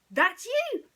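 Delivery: high, loud delighted cry; little girl's excited squeal; excited Lilo. Acted. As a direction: shout it up high with joy.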